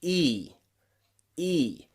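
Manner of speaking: E as a clean "ee" with widened lips; long E sound. Shown as a demonstration. Teacher showing learners how we make this sound, long E. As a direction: say E as a drawn-out "ee". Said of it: "We make this sound, watch closely".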